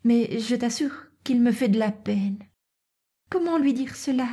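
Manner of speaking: high, gentle daughter's voice; troubled young Natacha. Acted.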